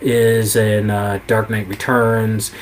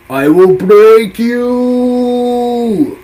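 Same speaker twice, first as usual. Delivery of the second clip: much higher, very slow, drawn-out roar; villain scream; 13 semitones higher, 8 dB louder, much slower than usual. Retold or imitated. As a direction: howl it like Bane breaking his foe. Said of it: "he screams out".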